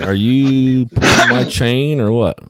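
are you kidding me, drawn out; incredulous. Retold or imitated. Driver at the forklift operator, incredulous and slow. Retold standoff with forklift guy.

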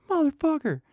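high-pitched mock curse shout; retold outburst. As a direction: blurt it out high, like the fish just got away. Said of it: "you know, out loud".

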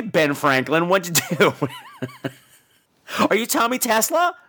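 ordinary talk

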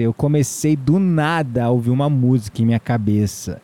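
usual speaking voice

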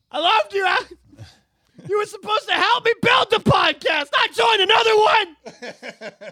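mock tragic outburst, slowing at the end; hammed-up anguished shout. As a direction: bellow it like a heartbroken Jedi. Acted tearful podcast breakup.

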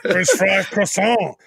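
slow fake French; mock French. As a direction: ham up a silly French accent. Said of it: "I also speak French".

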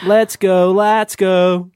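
loud, high American chant, stretched A; American accent. Pretend. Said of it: "I have a terrible American accent".

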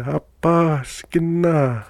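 mock yell, high and drawn out; high, stretched-out calling; imitated yell. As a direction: imitate a distant call without real volume. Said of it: "he was yelling".

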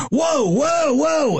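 high, drawn-up cries of alarm; mock alarm. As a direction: act out trying to halt things, pitched high. Acting out a startled reaction.

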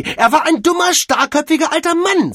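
shrieked-high, loud outrage; angry interruption. Acted. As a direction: burst in over his excuse, loud and high. Mavis cuts off his excuse. (wütend, laut und hoch dazwischenfahren)

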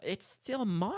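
imitating a famous actor's catchphrase; high-pitched, put-on celebrity voice; [name]. Quotes actor from favorite video.

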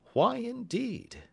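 ordinary delivery, nothing marked

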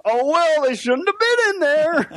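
high-pitched careless "oh well"; mock dismissive. Panelist mocking a candidate shrugging off bombing people, dismissive. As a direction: toss it off in a silly high voice.

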